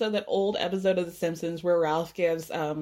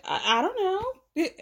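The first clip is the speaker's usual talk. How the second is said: mock clueless; 9 semitones higher than usual. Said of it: "she's like".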